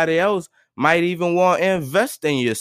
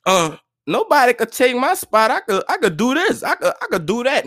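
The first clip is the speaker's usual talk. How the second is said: higher-pitched imitation of cocky boasters; mocking, pitched-up boast; mock overconfident people; 6 semitones higher than usual. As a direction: mimic people who think they're untouchable. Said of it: "so many people out here thinking".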